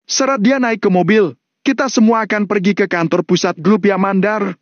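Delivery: high, emphatic gang leader voice; menacing Valentino. Acted. Valentino tells captive John he came for the debt, menacing.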